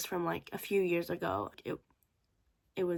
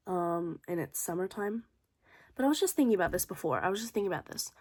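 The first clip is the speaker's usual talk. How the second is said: normal talking voice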